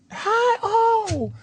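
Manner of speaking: high-pitched imitated wife; wife's greeting. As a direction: sing out a high hello as the wife. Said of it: "here comes his wife".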